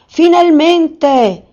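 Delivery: high, loud and very slow cry of triumph; a wizard gloating as he snatches the lamp; triumphant shout. Acted. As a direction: drag out the victory yell. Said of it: "gridò poi".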